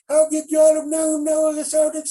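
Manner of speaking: high, loud desperate calling; panicked yelling. Acted. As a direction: yell his name in panic. Somebody yelling for him.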